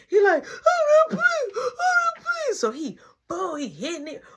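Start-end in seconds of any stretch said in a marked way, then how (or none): 0.4-2.6 high, loud tearful begging; hammed-up cry; mock wailing plea. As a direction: beg in a bawling squeal. Imitates boy pleading in a tantrum.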